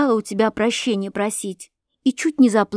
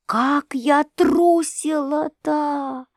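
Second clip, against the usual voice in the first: very slow, high-pitched drawl; drawn-out exclamation; 6 semitones higher, much slower than usual. A narrator's reading. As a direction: stretch every word, pitched up. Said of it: "протянула княжна". (протяжно, нараспев)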